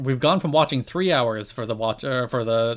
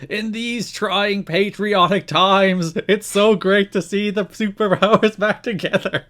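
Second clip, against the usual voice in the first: mock patriotic commentator; 7 semitones higher, slower than usual. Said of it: "Don West goes into full, like, pro America mode".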